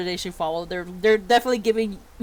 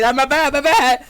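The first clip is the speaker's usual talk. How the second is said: loud, raised-pitch apology; called-out apology; 4 semitones higher, 8 dB louder than usual. Acted. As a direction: call back apologetically over your shoulder. Teacher calls apology back to ladies.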